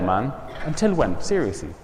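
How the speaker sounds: quiet, heartfelt urging; soft pleading. Retold or imitated. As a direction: drop the voice and plead. Pleading with the student.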